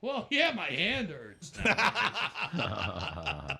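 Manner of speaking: dry reply breaking into slow laughter; deadpan it, then laugh. Retold or imitated. [name] joking back to his friend, laughing. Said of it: "I'm like".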